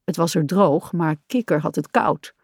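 ordinary delivery

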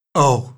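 loud despairing sighed oh. Acted. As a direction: let out a heavy hammed-up sigh. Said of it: "zuchtte meneer Tump".

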